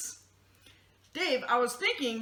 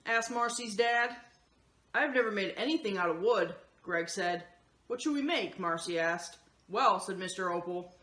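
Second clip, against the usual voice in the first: steady, lower storybook narration; reading to children; 5 semitones lower than usual. Acted. Host reading the picture book to the kids, in calm storybook narration. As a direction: read the story gently to the kids.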